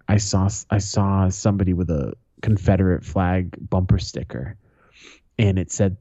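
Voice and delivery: ordinary delivery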